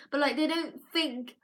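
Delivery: ordinary delivery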